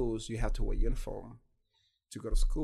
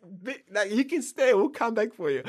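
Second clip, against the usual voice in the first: high, loud hurried goodbye; family leaving; 12 semitones higher, 8 dB louder than usual. Retold or imitated. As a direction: brush him off as they leave. Family leaving him behind, voiced.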